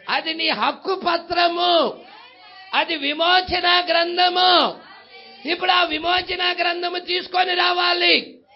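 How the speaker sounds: rousing sermon cry; full-force preaching. Acted.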